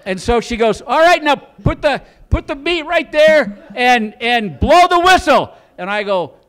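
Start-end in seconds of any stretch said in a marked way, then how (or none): 0.8-5.5 loud, high, slow instructions; calling out orders. Retold or imitated. Wife instructing her nervous husband, calling out orders. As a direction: call it out across a field.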